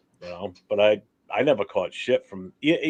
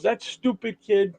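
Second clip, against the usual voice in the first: grandma voice; 8 semitones higher, slower than usual. Retold or imitated. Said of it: "She goes".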